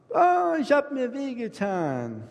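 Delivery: put-on high child's wail, stretched slow; whining hurt child. Same child wails to mama about the pain, high and slow. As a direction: cry out to mama that it hurts.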